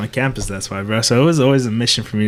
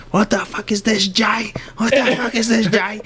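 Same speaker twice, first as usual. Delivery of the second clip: grandpa yelling; 10 semitones higher than usual. Retold or imitated. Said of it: "my grandpa starts yelling at me".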